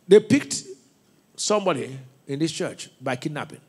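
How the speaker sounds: usual speaking voice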